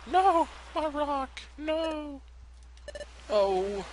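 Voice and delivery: high, slow little wail; Pikachu. Acted. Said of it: "Pikachu's like".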